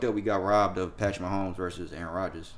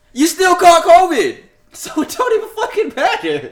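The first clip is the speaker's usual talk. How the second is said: much higher and louder, laughing through the words; high mocking laugh; 11 dB louder than usual. Real, not acted. Taunting Aaron Rodgers about catching covid, high laughing mockery.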